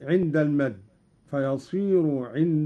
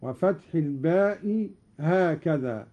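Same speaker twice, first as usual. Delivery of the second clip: normal talking voice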